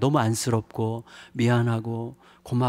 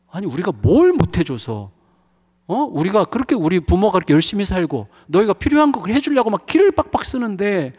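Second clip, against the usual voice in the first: imitating an exasperated father, higher and louder; frustrated parent; 8 semitones higher, 5 dB louder than usual. Father replaying his angry thoughts about his daughter, mock exasperated. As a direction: vent the old resentment in mock exasperation. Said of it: "막 이런 생각이 드는 거예요".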